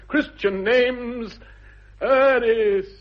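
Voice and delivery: high, loud, drawn out slowly; triumphant discovery. Acted. Jack reading his father's name, slow and triumphant. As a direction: savour the find, then crown it.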